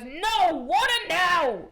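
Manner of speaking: put-on high, slow bossy toddler shout; loud high toddler demand. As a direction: bark it like a cranky three-year-old. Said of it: "sometimes he goes".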